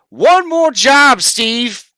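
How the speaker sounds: slow, loud, high singsong mimicry of the witch; mocking quote. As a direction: parrot her words back with scorn. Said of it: "You said".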